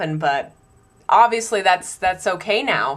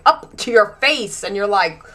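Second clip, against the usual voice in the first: ordinary talk, nothing marked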